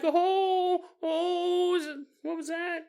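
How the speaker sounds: high wailing, drawn-out ohs; mock frightened moan. Acting the jumpy dying woman.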